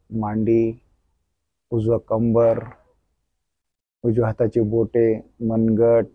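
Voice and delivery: soft, slow guided meditation voice; soft spa whisper. Acted. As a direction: lull them through a gentle body scan. Leads body-scan relaxation meditation.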